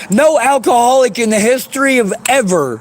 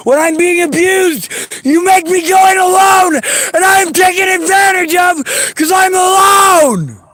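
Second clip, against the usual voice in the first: sobbing out the words in fury; crying shout; 5 semitones higher than usual. Real, not acted. Telling listeners how she was left alone, crying and shouting.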